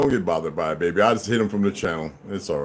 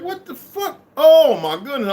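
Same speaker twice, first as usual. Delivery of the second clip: surprised; 7 dB louder than usual. Retold or imitated. Quoting his own reaction.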